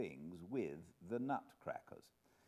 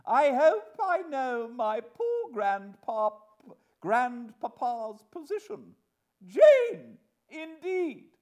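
slow, high, loud haughty outrage; imperious wife; 15 dB louder, slower than usual. Acted. Wife invoking grandpapa's position, haughtily indignant. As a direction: draw it out grandly, then explode on the name. Wife standing on her family rank.

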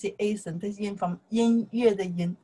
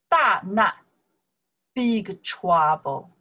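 ordinary talk, nothing marked

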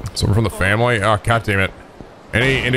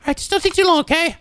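quick, very high falsetto; girl character voice. Acted. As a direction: answer as a little girl in squeaky falsetto. Voices the girl's reply.

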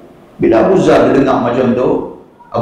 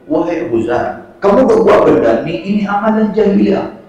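higher, raised preaching voice; impassioned sermon rebuke; fiery preaching; 8 semitones higher than usual. Acted. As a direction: preach it up high, rebuking with fervour.

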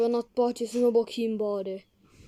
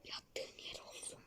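whispering; 25 dB quieter than usual. Pretend. Mocking the poor man.

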